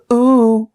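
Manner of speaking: falsetto ooh to end a song; high sung ooh. Pretend. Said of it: "a new tune".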